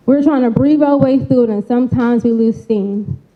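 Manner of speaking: ordinary delivery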